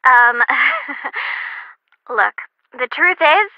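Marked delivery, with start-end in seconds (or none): 0.5-1.6 awkward laugh. Acted. Awkward laugh before hard truth.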